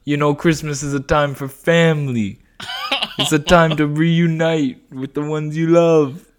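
a hammed-up wiseguy getting sappy about family; New York Joe. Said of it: "New York Joe".

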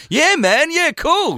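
high, bright eager agreeing; chirpy yes man voice. Retold or imitated. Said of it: "Stevie's a real yes man".

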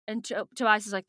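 usual speaking voice

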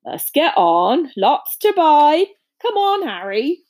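loud, drawn-out rustic call; slow, hearty holler to move along; calling over babble. A narrator's reading. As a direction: make yourself heard over a noisy crowd. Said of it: "make himself heard over the babble".

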